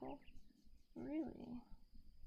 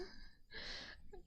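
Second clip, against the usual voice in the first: soft breathy laugh; 12 semitones higher, 7 dB quieter than usual. Real, not acted. Laughs at herself.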